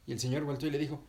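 usual speaking voice

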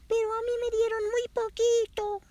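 squeaky little chick voice, very high; voz de pollito. A narrator's reading. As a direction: pipe it up like a tiny chick. Berilio habla, voz muy aguda. (vocecita de pollito muy aguda)

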